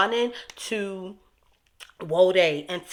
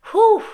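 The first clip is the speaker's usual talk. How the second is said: exasperated exhale; 11 dB louder than usual. Real, not acted. Exhaling after angry rant.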